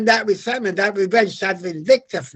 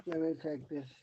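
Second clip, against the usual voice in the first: ordinary talk, nothing marked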